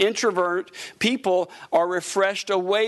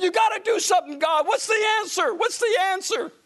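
high, pleading shout to God; desperate cry; 10 semitones higher than usual. Retold or imitated. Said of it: "I said".

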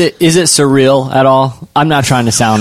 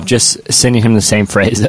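normal talking voice

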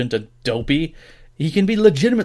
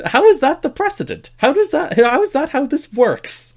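much higher and louder, baffled disbelief; incredulous rant; 12 semitones higher, 5 dB louder than usual. Retold or imitated. Fan retelling his reaction to the replaced team, incredulous outrage. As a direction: demand it like the rules make no sense. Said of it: "I'm like".

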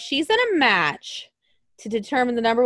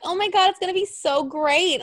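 higher, gushing fan voice; mock gushing fan; 8 semitones higher than usual. Mocking fans hyped for the storyline, gushing. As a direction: ham up an excited fan. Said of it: "everybody else was like".

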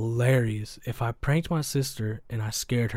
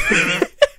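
high laugh; 9 dB louder than usual. Real, not acted. Laughs at own story.

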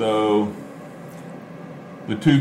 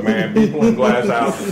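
playful high teasing; 11 semitones higher than usual. Acted. Teasing friend about spoiling news.

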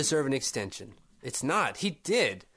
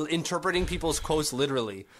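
usual speaking voice

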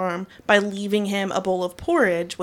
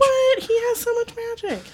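high-pitched, playful indignation; incredulous protest. Real, not acted. Host defends the gnome's magic, incredulous squeal.

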